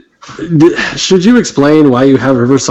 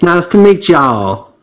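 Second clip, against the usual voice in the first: slow, sweet Southern greeting; a light Southern accent, drawn out; lightly voiced Southern belle; Southern charm; 3 semitones higher, slower than usual. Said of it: "Delta Blues".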